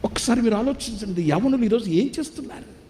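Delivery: high, impassioned sermon appeal; preaching at full force. Acted. Preacher appealing to the congregation, high and impassioned. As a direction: plead with the brothers from the pulpit.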